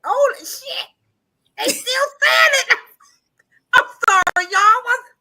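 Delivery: high, loud laughing cry; laughing hard. Real, not acted. Cracking up at the chat, high laughing shouts. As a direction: break into helpless laughter.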